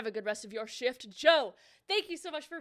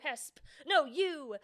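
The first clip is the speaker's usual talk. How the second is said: ordinary delivery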